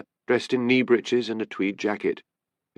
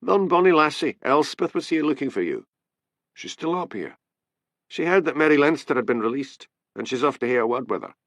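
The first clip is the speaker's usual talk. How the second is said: higher, chatty Highland voice; Dick the Highland constable; 7 semitones higher than usual. A narrator's reading. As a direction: gossip it to Hamish, eager. Dick's voice in the story.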